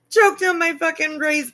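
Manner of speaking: half-choked, laughing words; choking laugh. Real, not acted. Streamer chokes on a raisin from laughing. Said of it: "I almost felt choked".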